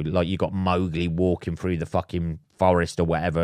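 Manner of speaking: ordinary delivery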